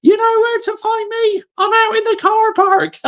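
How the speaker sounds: high mocking impression of the rant. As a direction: pitch it way up like a cartoon of the angry fan. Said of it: "one of the funniest clips ever".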